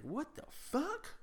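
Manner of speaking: high, slow, drawn-out what the fuck; startled high-pitched curse in the dark; shocked exclamation. Retold or imitated. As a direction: stretch it out high in disbelief. Neighbour hit by something wet.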